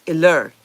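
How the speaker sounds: "iller" with LER punched loud; stress on second syllable. Shown as a demonstration. Confirming the accent for viewers, "iller" stressed loudly on LER. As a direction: hit LER hard in "iller". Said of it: "Second syllable".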